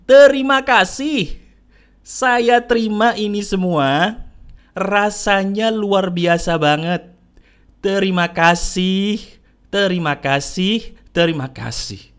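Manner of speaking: very slow, measured affirmation; spoken out loud. Shown as a demonstration. Speaker leads the audience through repeated thanks, slow and out loud. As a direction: say each phrase slowly, leaving room to repeat. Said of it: "kamu ikutin perkataannya begini, ikutin".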